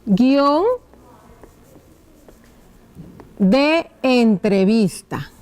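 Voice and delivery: slow, with a long pause mid-title; writing-out dictation. Shown as a demonstration. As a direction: dictate it drawn out while writing. (dictado lento, alargando las palabras)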